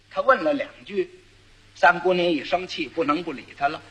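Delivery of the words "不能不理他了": ordinary delivery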